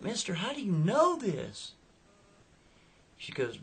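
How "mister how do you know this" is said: high, freaked-out woman's wail; Becky. Retold or imitated. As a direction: imitate a girl alarmed by a stranger. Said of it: "She's freaking her out".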